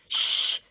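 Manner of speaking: quiet shush. Acted. Nanny shushing the boy quietly. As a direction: hush him quietly. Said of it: "shushed Nanny as quietly".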